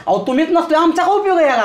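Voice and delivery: high-pitched, insistent appeal; earnest pleading. Acted.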